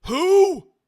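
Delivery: very high, loud bellow; shouting. Acted. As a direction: yell it from behind a door. Boss shouts from office.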